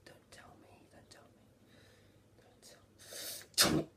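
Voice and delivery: very faint, unvoiced whisper; imitated whisper. Acting out the anime moment, faint suspenseful whisper. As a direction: barely breathe the words.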